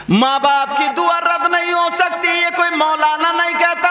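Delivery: high, loud, slow chanted sermon; full-force preaching. Acted. Preacher proclaiming the Prophet's words on parents' prayers. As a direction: preach the hadith over the crowd.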